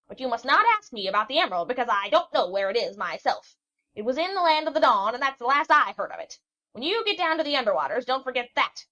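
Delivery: put-on an old witch scolding the young sailor; cranky old witch. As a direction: rattle on like a fussy old hag.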